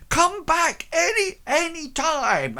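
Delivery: high, loud parting call; fawning baker. Acted. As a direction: call out sweetly as they leave. Baker calls after them.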